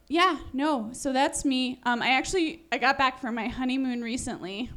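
normal talking voice

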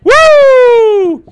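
loud high whoop; party cheer; excited whoop. Real, not acted. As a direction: whoop to fire up the room. Comic hyping the crowd.

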